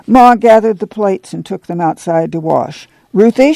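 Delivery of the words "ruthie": raised, higher-pitched call. A narrator's reading. Mother summoning her children for a chore, calling out. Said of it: "she called".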